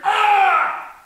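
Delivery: loud, high drawn-out "ah"; exasperated scream. Acted. Comic capping the awkward chant, screaming. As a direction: let out a big held yell.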